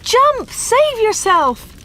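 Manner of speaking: very high, loud urgent shouts; shouting. A narrator's reading. Villagers urging Dave out of the burning shop, shouting. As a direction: shout a desperate plea, drawing out the end. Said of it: "they shouted".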